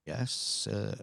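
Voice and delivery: sarcastic yes sir in an odd voice; mock submissive. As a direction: play it coy and mocking.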